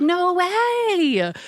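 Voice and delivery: a high, amazed no way; delighted disbelief. Retold or imitated. Telling the artist she can't believe it, high and amazed. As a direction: gasp it out like you can't believe your luck.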